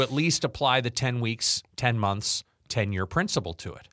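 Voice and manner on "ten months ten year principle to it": usual speaking voice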